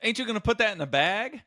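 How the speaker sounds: high, fast silly catchphrase; goofy catchphrase voice. Retold or imitated. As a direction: pitch it way up like a joke line. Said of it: "that was my friend Spanky's idea".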